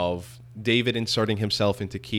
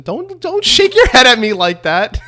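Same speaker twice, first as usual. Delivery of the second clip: high, loud mock outrage; playful scolding; 13 semitones higher, 12 dB louder than usual. Real, not acted. Host to co-host shaking their head, loud playful scolding. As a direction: yell it in amused protest.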